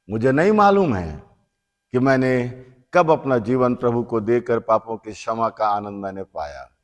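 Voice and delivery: ordinary talk, nothing marked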